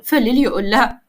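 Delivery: plain reading voice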